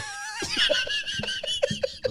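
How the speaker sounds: shrieking high laugh; real shrieking laugh; screaming laugh. Real, not acted. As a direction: howl with laughter at the joke. Reacts to his own joke.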